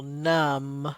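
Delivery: "Num" held out on its own; held syllable. Shown as a demonstration. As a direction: stretch "Num" to feel the whole syllable. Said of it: "Hold the word to feel the syllable".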